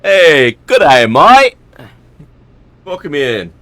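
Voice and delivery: loud, high Australian "good day, mate"; Aussie greeting. Shown as a demonstration. Streamer greeting a viewer in a loud Australian accent. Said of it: "I like that one better".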